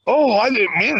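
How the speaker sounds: high-pitched imitation of excuse-making; mock pleading excuse. Storyteller as thief in back room, mock whiny excuse. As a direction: plead innocence as a caught thief.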